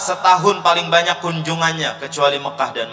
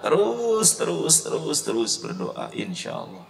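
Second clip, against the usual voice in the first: fading to whisper; 5 dB quieter than usual. Real, not acted. Fading urging.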